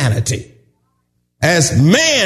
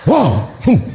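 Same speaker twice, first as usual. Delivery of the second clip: ordinary talk, nothing marked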